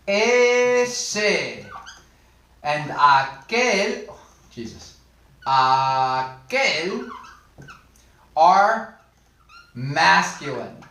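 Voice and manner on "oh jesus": whispered aside. Real, not acted. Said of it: "exasperated aside".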